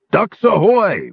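slow, high, loud sing-out; calling out. A narrator's reading. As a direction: sing it out like a lookout. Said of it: "Roperig sang out".

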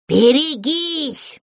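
slow drawn-out warning shout; warning cry. A narrator's reading. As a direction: yell out a warning while flying. Брякля предупреждает, падая. (протяжный предостерегающий крик)

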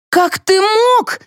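high, drawn-out cry of reproach. A narrator's reading. Wife reproaching her husband, crying out. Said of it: "закричала Флора".

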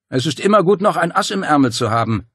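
smug gloat; gloating villain. Acted. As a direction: savour the trick. Dario gloating.

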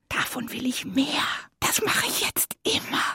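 hushed, voiceless whisper; excited whisper. Acted. Fairy confides her new craving, excited whisper. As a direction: whisper it like a secret resolve.

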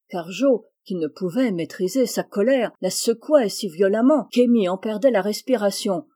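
ordinary delivery, nothing marked

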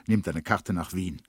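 ordinary talk, nothing marked

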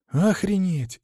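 high, exhaled amazement; breathed out, amazed. A narrator's reading. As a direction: let it out on a breath. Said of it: "выдохнул Артур".

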